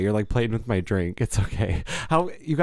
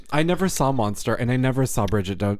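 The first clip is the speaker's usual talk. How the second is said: ordinary delivery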